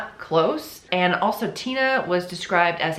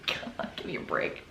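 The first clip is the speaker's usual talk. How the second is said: whispery, much softer aside; muttered exasperation; 7 semitones lower, 13 dB quieter than usual. Real, not acted. Host fed up with the lie, muttered exasperation. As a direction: mutter it under your breath.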